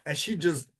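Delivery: ordinary talk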